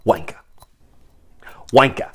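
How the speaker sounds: sly whispered heckle; whispered insult. Acted. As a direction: whisper it sneakily.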